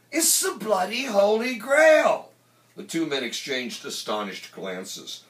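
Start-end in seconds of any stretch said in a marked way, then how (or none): 0.1-2.3 loud, high-pitched amazement; excited put-on character shout; awestruck Teddy Boy exclamation. A narrator's reading. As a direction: cry it out like a priceless find. Teddy Boy marvels at records.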